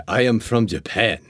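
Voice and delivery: mocking the heavy Japanese accent the casting asked for. Retelling an audition script, a lightly mocked Japanese accent. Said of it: "so this is accented".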